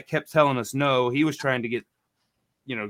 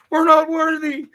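high, loud hammed-up "not worthy"; mock grovelling worship; 8 dB louder than usual. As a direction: worship the cop in a squeaky shout. Bowing to the cop.

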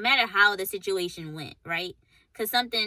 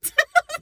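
burst of laughter; 12 dB louder than usual. Real, not acted. Friend laughs at miserable relationship.

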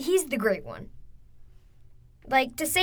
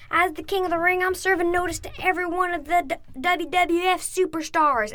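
imitating Stone Cold; 7 semitones higher than usual. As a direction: taunt the old preacher, building to the punchline. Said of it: "And he said".

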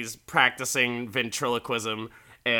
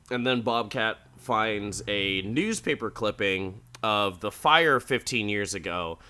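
ordinary talk, nothing marked

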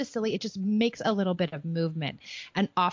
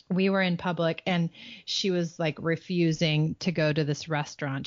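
usual speaking voice

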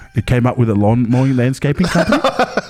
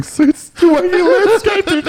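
laughing. Real, not acted. Telling friends about the suit shop punchline, laughing hard. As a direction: lose it as you finish the thought.

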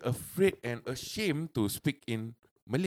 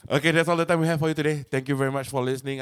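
podcast sign-off; 3 semitones higher, 7 dB louder than usual. Real, not acted. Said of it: "sign-off of the show".

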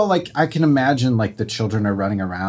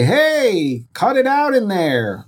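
raised parent voice; 10 semitones higher, slower than usual. Retold or imitated. Parent calling to kids three rooms away, raised voice. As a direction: call out to the kids to stop. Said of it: "you raising your voice because you're literally three rooms away".

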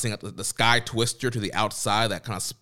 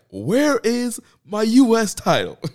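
much higher, louder and slow, hammed-up; mock outraged yell; 14 semitones higher, 9 dB louder, slower than usual. Host imitates Ospreay demanding his US title, yelling. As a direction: bellow it like a robbed champ. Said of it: "He's like".

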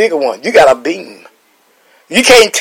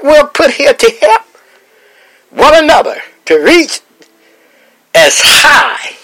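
climbing higher as each title gets called out; rising preacher call; 9 semitones higher than usual. Acted. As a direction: preach it up, calling out every title. Calls out each church title.

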